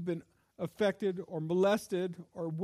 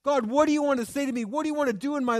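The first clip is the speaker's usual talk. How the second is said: repeated louder and higher, urgent; stronger plea; 6 semitones higher, 5 dB louder, faster than usual. Acted. Preacher repeating his question to God, louder and pleading. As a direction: press the question harder to God.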